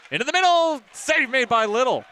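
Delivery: high, loud shout of the chance; excited play-by-play. Real, not acted. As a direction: belt it out as the save happens. Big chance and save.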